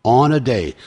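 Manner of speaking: usual speaking voice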